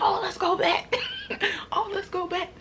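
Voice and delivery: high, laughing "let's go back"; egging her on through laughter; hyped friend laughing. Retold or imitated. Hyped friend urging a return, laughing.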